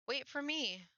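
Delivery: high pleading shout; shouting after her. Acted. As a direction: cry out for her to stop running. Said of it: "Though I shout for her to stop".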